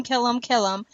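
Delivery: ordinary talk, nothing marked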